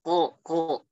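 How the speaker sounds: ko with no breath released; unaspirated qaf. Shown as a demonstration. Teacher showing breathless ko. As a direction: hold the breath back on ko. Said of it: "nggak ada keluar nafas dia".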